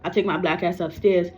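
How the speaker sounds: ordinary delivery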